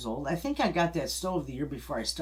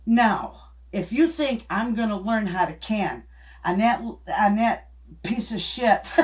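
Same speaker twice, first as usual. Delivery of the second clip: louder, higher indignant scolding, hands on hips; mother's indignant outburst; 5 semitones higher, 5 dB louder than usual. Retold or imitated. Quoting mother's scolding to husband, indignant and louder. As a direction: give him a piece of your mind, mock-stern. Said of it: "she put her hands on her hips".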